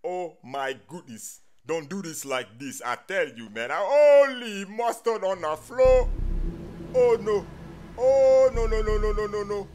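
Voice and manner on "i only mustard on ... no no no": panicked. Acted. Blue finds a body.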